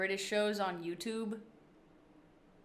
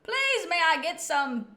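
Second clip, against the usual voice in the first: higher, louder put-on English accent; mock English accent; 6 semitones higher, 5 dB louder than usual. Asked for best English accent, put-on English accent. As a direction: attempt a polite English request. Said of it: "give us your best English accent".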